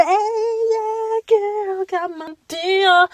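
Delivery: celebrating her lunch, playful high sing-song; giddy sing-song. Retold or imitated. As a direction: croon it like a little victory song. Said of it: "I was like".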